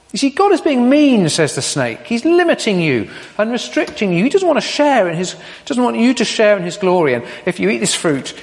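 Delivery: a hint of a persuasive serpent; snake voice. Preacher voicing the snake's lie.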